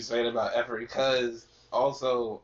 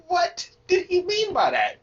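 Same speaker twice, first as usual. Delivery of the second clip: loud, high mock bewilderment; baffled outcry; 7 dB louder than usual. Recalling his reaction to a puzzling lyric, baffled high outcry. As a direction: cry out as if utterly baffled.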